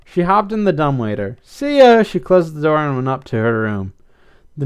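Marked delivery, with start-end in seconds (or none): 1.4-2.0 high, loud cheerful farewell; called goodbye. A narrator's reading. Blythe calls to the pets from the dumbwaiter, called goodbye. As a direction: call it out on the way off.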